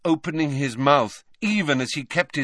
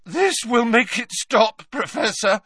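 high, strained voice close to breaking; Harry, voice cracking; 8 semitones higher, 4 dB louder than usual. A narrator's reading. Harry feeding the professor potion, voice cracking. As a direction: urge it out with a cracking voice. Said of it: "his voice cracking".

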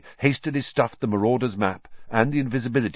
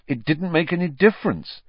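bitter, resentful complaint; 8 semitones higher than usual. A narrator's reading. Harry to Dumbledore after Pettigrew escapes, bitterly. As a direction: spit it out in bitter frustration. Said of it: "said Harry bitterly".

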